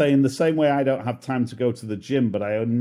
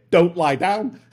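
mock British doctor; 6 semitones higher, 6 dB louder, slower than usual. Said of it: "if this was a British doctor".

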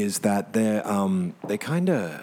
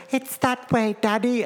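put-on child voice; 12 semitones higher than usual. Child answer in story.

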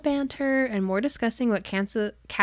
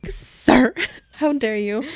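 hammed-up indignation, much louder; mock outrage; 3 semitones higher, 7 dB louder, much slower than usual. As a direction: clutch your pearls, playfully. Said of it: "And I'm just like".

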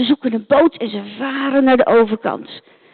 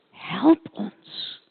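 softer, fainter plea for help; soft echo; 3 semitones lower, 7 dB quieter than usual. Acted. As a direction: let it fade like a far-off echo. Echoed call for help. (zacht nagalmend smeken)

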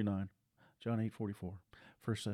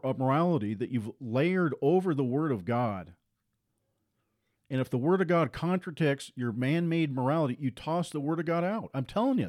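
raised, emphatic pulpit delivery; full-force preaching; 4 semitones higher, 4 dB louder than usual. Acted. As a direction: drive each line home to the congregation.

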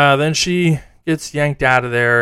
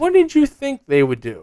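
exasperated yell; 12 semitones higher than usual. Real, not acted. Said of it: "watching some of these videos going".

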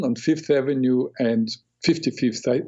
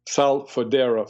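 usual speaking voice

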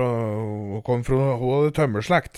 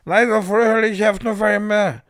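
a sharp, louder and higher "shut up"; angry outburst; 7 semitones higher, 5 dB louder than usual. Acted. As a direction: tell them off for interrupting. Snaps at interrupting listener. (sint utbrudd mot den som avbryter)

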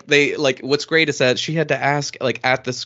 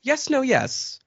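brisk dismissal; 8 semitones higher, 3 dB quieter, slower than usual. Acted. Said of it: "But here it's very like".

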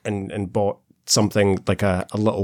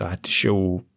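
ordinary talk

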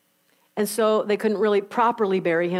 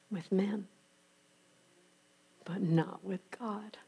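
hushed whisper; 3 semitones lower, 12 dB quieter, slower than usual. Real, not acted. Preacher whispers God's power to the congregation. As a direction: whisper it softly. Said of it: "preacher drops to a whisper".

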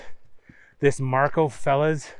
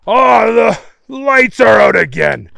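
loud, raised-pitch complaint; annoyed shout; 7 semitones higher, 10 dB louder than usual. A narrator's reading. Marco finding the tunnel lights out, annoyed shout. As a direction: holler it into the dark tunnel.

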